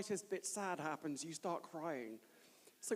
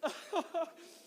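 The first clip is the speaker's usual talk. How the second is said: high-pitched helpless laugh; real giggling fit; 10 semitones higher than usual. Real, not acted. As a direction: burst out laughing uncontrollably. Laughing fit at own joke.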